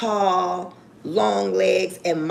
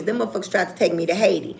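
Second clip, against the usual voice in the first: ordinary talk, nothing marked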